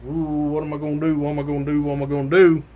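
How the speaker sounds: lightly imitating an anxious man talking to himself; mild mock panic; mock nervous muttering. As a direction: fret in a repeated loop, half to yourself. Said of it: "he's a very nervous fella".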